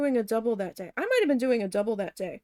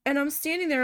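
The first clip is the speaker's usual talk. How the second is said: ordinary talk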